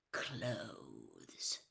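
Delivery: breathy whisper, low and final; whispered verdict. A narrator's reading. Mister Crouch sentencing Winky, whispered verdict. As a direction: drop it to a chilling whisper.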